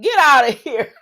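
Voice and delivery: loud, high mock incredulity; imitating an amused, loud scoff; laughing disbelief. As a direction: burst out in acted disbelief, laughing. Acted incredulous reply, laughing.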